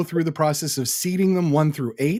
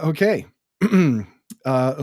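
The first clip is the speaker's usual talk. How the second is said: normal talking voice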